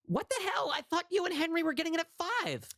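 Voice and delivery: high delighted squeal; excited greeting. Real, not acted. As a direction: greet your best friend with joy. [name] greets friend excitedly.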